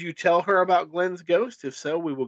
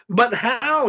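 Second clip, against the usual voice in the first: raised, pleading question; louder, higher, at a loss; hopeless question; 8 semitones higher, 6 dB louder than usual. A narrator's reading. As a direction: ask it with mild despair.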